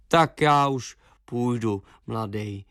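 put-on an old hound ambling off; old dog leaving. A narrator's reading. Old dog Alex says goodbye.

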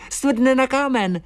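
high, eager little voice cutting in; interrupting swallow. A narrator's reading. Swallow finishes his friend's explanation, high interjection. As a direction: jump in high and quick to finish the thought. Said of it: "skočil mi do řeči Filip".

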